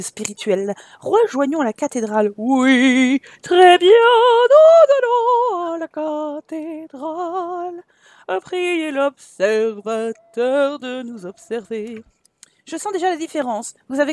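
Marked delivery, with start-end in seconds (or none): 2.4-12.0 high, slow, loud churchy chant; hammed-up solemn priest leading the faithful; pompous priest. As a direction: intone it like a mock cleric.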